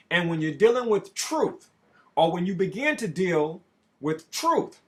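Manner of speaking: loud, rising sermon delivery; full-force preaching. Acted. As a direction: proclaim it like a preacher at the pulpit.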